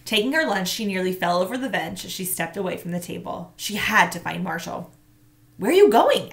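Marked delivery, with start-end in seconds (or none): 5.6-6.3 calling after. A narrator's reading. Monica calls after Tamaya.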